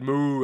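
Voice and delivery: loud mooing call; cow's moo. Retold or imitated. Cow answering the farmer, loud mooing call. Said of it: "Then the cow said".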